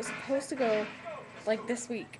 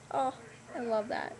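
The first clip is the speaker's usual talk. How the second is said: ordinary delivery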